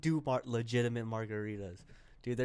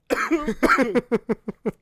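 harsh choking coughs; coughing fit; 9 dB louder than usual. Real, not acted. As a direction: cough hard after a shot. Shot went down wrong pipe.

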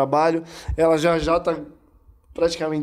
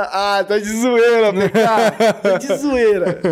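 slow, teasing laugh through the words; mocking friends laughing; 5 semitones higher, 5 dB louder, slower than usual. Friends laughing off his complaint as a joke. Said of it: "os caras já".

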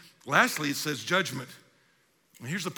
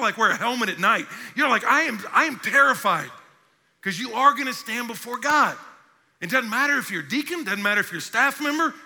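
raised, high, urgent preaching voice; full-force preaching; 6 semitones higher, 5 dB louder than usual. Real, not acted. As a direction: drive the warning home to the congregation.

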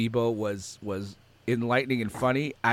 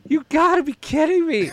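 mock disbelief; 14 semitones higher, 4 dB louder than usual. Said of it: "I was just like".

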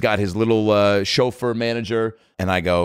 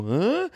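high, short questioning "huh"; a high little sound of amusement; imitated little huh; 10 semitones higher, 4 dB quieter than usual. Paul checks in during the show, high imitated huh. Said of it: "feel him just go".

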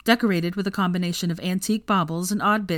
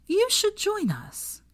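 high, slow, sing-song offer; sly invitation; much slower than usual. A narrator's reading. Hermione sweetly inviting Veronica along in a high, slow voice. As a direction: draw it out like a tempting idea.

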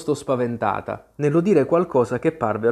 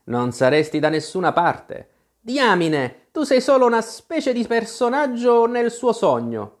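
high-pitched contemptuous put-down; scornful retort; 10 semitones higher, 3 dB louder than usual. A narrator's reading. Tweedledee belittles Alice as a dream figure, scornful. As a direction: lift the voice and belittle the listener. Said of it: "ribatté con disprezzo Tweedledee".

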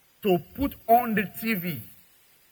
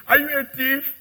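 scolding parent; 7 semitones higher, 6 dB louder than usual. Retold or imitated.

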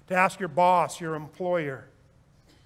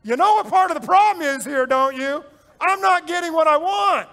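mock confession; 7 semitones higher, 6 dB louder than usual. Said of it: "if you pause and say".

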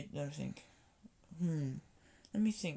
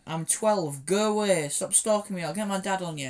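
put-on loud annoyed kid; 3 semitones higher, 8 dB louder than usual. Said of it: "I went".

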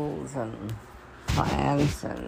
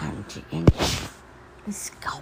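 whispered aside; 6 semitones lower than usual. Retold or imitated. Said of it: "So I'm like".

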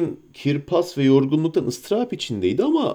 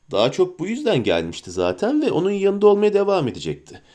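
usual speaking voice

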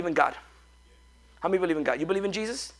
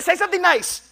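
ordinary talk

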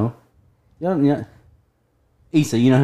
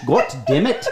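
put-on a light English accent on "God damn it"; "God" rounded like "gawt", English style. Mock English accent, joking about Brits swearing. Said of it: "Maybe if they're English".

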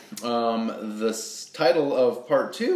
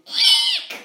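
high squeaky eek; tiny krill squeak; 8 dB louder than usual. Acted. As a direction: squeal like a scared little krill. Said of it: "and he's saying".

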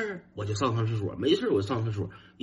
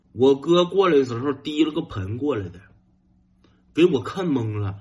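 ordinary delivery

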